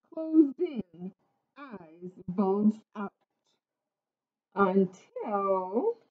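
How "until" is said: 引き伸ばした「until」、上がり調子で間を溜める; 「until」をゆっくり伸ばし、語尾を上げて次を待たせる; drawn-out suspense. A narrator's reading. Suspense before the twist.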